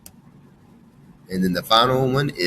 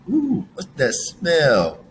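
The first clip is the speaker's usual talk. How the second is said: slow, higher Ooh, what's that smell?, curious and wondering; playful curiosity; 8 semitones higher, slower than usual. Shown as a demonstration. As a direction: sniff around, intrigued. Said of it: "depends on how we're using it".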